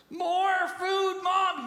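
put-on high, loud child's demand; whiny kid. Kid in back seat demanding food from mom, whiny shout. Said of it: "a kid in the back seat".

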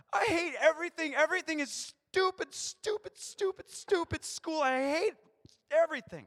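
put-on high, loud sulky child voice; whiny kid. Kid complaining to parent about school: high, loud whine.

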